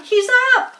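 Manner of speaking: crowd cheering. A narrator's reading. Crowd cheers the revived pig, excited yelling. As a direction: whoop for the pig. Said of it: "yelled the crowd".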